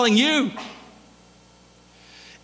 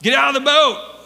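higher and louder, a harsh angry shout from the shore; angry call from shore; 8 semitones higher, 7 dB louder, slower than usual. Retold or imitated. Imagined God yelling at Peter to get out, angry shout. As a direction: bark it like a stern master scolding a failure. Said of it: "with an angry tone".

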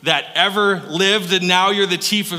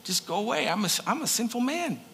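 ashamed plea; 5 semitones higher, 6 dB quieter than usual. Retold or imitated. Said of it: "he had this sense of".

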